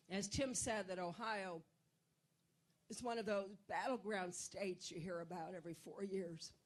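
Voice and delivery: usual speaking voice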